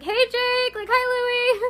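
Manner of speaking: high, drawn-out sing-song hello; excited fan greeting. Retold or imitated. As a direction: call out to them like a giddy fan. Fans calling out to band members.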